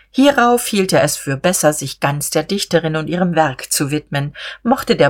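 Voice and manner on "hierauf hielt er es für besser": ordinary delivery, nothing marked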